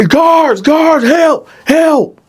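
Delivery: very high, loud cry for the guards; Dylan crying out to the guards for help; calling for help. Retold or imitated. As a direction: scream for help in a panic.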